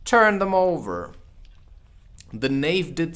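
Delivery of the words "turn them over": higher, louder curt order; angry Queen. Acted. The Queen angrily orders the Knave. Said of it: "turned angrily away".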